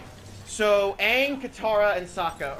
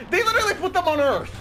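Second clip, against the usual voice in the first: much higher, louder disbelieving cry; real incredulous yell; exasperated outburst; 10 semitones higher, 6 dB louder than usual. Real, not acted. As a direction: shout it in disbelief. Exasperated reveal of movie absurdity.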